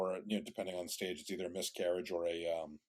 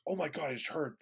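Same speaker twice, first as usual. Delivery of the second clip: excited congratulations; 8 semitones higher, faster than usual. Retold or imitated. Coworker gushes over pregnancy news.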